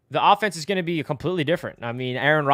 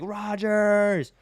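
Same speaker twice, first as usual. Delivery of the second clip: higher, drawn-out shout of the name; wearing a Rodgers shirt, yelling his name at the camera; 6 semitones higher than usual. Retold or imitated. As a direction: belt out Rodgers like a chant. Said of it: "I just start yelling".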